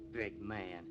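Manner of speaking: a low scornful aside; bitter quiet echo. Acted. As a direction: repeat it to himself with contempt. Bitter echo of his own words.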